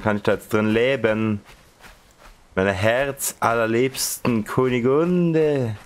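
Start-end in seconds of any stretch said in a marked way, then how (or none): 2.5-5.8 slow, high pompous serenade; hammed-up knightly romance; mock courtly lover. Mock courtly love speech. (theatralisch höfisch)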